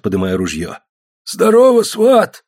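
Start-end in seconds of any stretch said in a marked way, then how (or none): 1.2-2.4 loud, high, hoarse hail; hoarse greeting. A narrator's reading. Old comrade hails the returned man, hoarse shout. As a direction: rasp out a hearty shout. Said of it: "прохрипел Федосеич".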